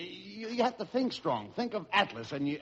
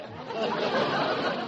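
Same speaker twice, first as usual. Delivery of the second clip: short breathy laugh; quick laugh at the gag; 4 semitones higher than usual. Real, not acted. Straight man laughs at the home garden joke.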